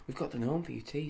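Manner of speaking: high, loud and fast; excited. Acted. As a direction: blurt it out eagerly. Joe eager after the chase.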